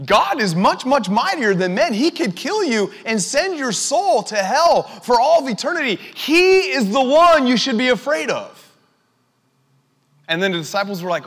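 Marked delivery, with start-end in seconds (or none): 0.1-8.7 higher, louder, emphatic sermon voice; forceful preaching. Real, not acted. Preacher warning the church to fear God, forceful and loud. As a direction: preach it with rising force, stretching key words.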